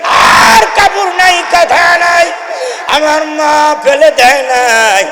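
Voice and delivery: high, loud tearful wail; weeping sermon cry. Acted. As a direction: cry out through tears over the mother. Preacher weeps while preaching.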